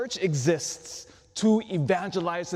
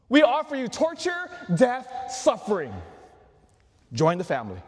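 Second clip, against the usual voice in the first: higher, louder hammed-up pitch shout; mock sales pitch; 6 semitones higher, 4 dB louder than usual. Priest mocking a ridiculous sales pitch, hammed-up shout. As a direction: sell suffering like a cheesy ad. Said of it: "What a ridiculous sales pitch".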